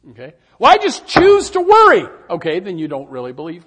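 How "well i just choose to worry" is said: mock defiant excuse. Believer excusing worry.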